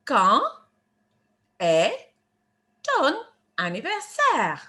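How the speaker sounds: one French word at a time, pauses between. Shown as a demonstration. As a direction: break the question into separate, deliberate words. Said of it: "How do we say that in French".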